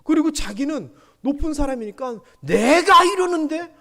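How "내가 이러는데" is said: loud, high, slow superior challenge; arrogant boss. Retold or imitated. The boss demanding respect from everyone, slow and arrogant. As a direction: lord it over them. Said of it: "막 이런 분위기로".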